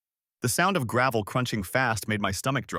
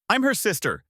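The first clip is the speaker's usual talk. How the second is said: high-pitched indignant screech; 9 semitones higher, 4 dB louder than usual. A narrator's reading. Sister pushing past the man blocking her, screeching. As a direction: screech it out in protest. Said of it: "she screeched".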